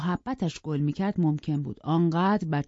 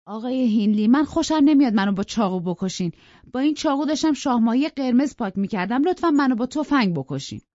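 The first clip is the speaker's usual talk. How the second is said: mock pleading; 6 semitones higher, 5 dB louder than usual. Nelly answers with mock calm.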